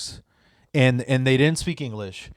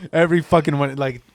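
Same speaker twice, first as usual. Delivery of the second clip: normal talking voice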